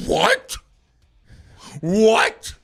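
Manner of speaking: high, loud disbelieving yell of What; dad's shocked yell. Retold or imitated. Dad yelling in disbelief when his son comes home. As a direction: shout it twice like a stunned dad hearing bad news. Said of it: "He goes".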